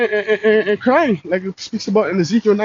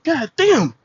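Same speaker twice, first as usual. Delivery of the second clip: mock exasperation; 8 semitones higher, slower than usual. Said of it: "It's like".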